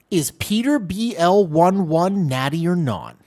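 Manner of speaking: a light stab at a high-voiced guy, drawn out; imitating Greg. Streamer tries out Greg's voice for chat, lightly imitated. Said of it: "I can't do the voice".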